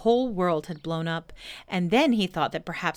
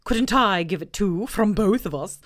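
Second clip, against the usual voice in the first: Owl voice; 2 semitones higher, 5 dB louder than usual. Acted. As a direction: boom it out like a pompous owl. Owl asking.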